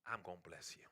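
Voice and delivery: a hushed divine blessing; the Lord's voice. Retold or imitated. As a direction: murmur it low like God speaking. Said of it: "I heard the Lord say".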